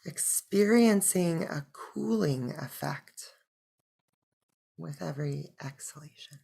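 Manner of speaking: soft, unhurried meditation voice; hushed breath-by-breath guidance; guided meditation. Acted. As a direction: guide them gently into stillness, with long pauses.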